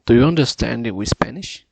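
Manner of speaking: loud, deliberate speech for the app; practicing a sentence for the pronunciation app, loud and deliberate; loud careful repeat. Shown as a demonstration. Saying it loud for the machine to catch. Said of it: "Eu falei we Spanish".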